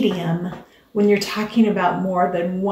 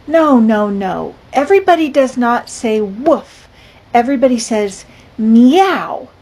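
put-on lively, louder storybook reading for kids; picture book read-aloud; 3 semitones higher, 7 dB louder than usual. A narrator's reading. Teacher reading a picture book to her class, lively.

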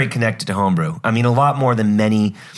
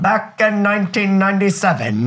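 high 1940s newsreel announcer voice; old newsreel mid-Atlantic accent; 10 semitones higher than usual. Acted. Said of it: "mid-Atlantic accent".

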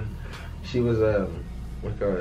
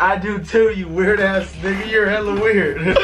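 a light mocking imitation of his friend, laughing at the end; mocking your reply; 8 semitones higher, 4 dB louder than usual. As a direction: parrot his answer back in a lightly mocking voice. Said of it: "And you responded with".

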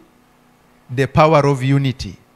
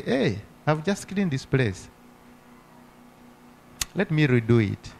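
much softer, gently unbothered; calm reply; 9 dB quieter than usual. Retold or imitated. Believer calmly answering the neighbour who dumped trash, soft and patient. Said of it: "come out calmly and say".

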